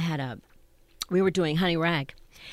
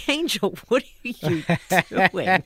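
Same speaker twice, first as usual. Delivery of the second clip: imitating laughing co-star; 6 dB louder than usual. Co-star spotting the toilet paper, imitated as laughing. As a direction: play a co-star cracking up mid-question. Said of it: "she starts laughing and says".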